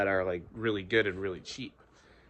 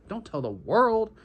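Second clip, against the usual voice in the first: emphatic plea; 10 semitones higher, 6 dB louder than usual. Real, not acted. Urging listeners.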